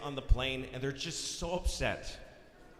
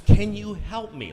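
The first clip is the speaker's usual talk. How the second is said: louder and slower, trying to reach him; louder, slower plea; 4 semitones higher, 9 dB louder than usual. Retold or imitated. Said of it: "Like she's trying to find his frequency".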